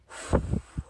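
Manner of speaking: hard gusty exhale; huff and puff blowing. Shown as a demonstration. Teacher showing children how to blow houses down, hard blow. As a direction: blow out forcefully like the big bad wolf. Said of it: "blow it down".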